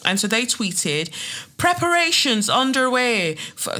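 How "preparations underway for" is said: high-pitched attempt at a Northern Irish accent; bad Northern Irish accent. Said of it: "that was a shit accent".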